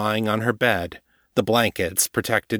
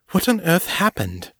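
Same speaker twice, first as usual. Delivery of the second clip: higher, shocked question; alarmed question; 9 semitones higher than usual. Acted. Morgan asking her wounded friend what happened, alarmed and higher.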